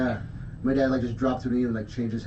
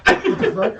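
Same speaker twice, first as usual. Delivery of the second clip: high, loud mock disbelief; retold startled reaction; 6 dB louder, slower than usual. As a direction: replay your own shocked reaction, pitched up.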